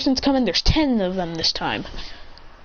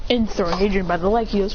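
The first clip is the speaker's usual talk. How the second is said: plain reading voice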